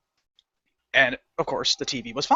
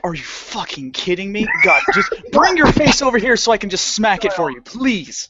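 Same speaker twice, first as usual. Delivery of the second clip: raised, incredulous mock rant; exasperated outburst; 5 semitones higher than usual. Clerk's inner rant at a stubborn customer, exasperated.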